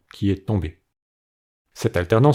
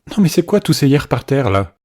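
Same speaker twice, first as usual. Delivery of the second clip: mock outrage; 6 semitones higher, 3 dB louder than usual. Comic outro, mock indignation.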